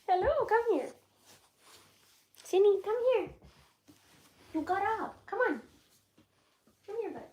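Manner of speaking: very high, sweet coaxing calls; calling a dog. Real, not acted. Owner urges the dog to come, high coaxing. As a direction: coax the pet over in a high little voice.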